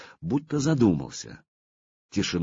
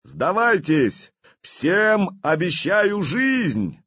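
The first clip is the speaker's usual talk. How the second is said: slow, much higher, loud call; commanding shout; 9 semitones higher, 3 dB louder, much slower than usual. Acted. Vladimir offers the enemy their lives, commanding shout. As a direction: roar a promise across the battlefield. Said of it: "крикнул он во всю мощь".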